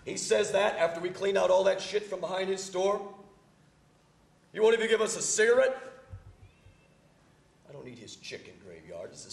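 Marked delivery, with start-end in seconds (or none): 0.0-5.8 loud, high and indignant; outraged shouting. Acted. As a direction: rant in disbelief. Outraged at the refusal.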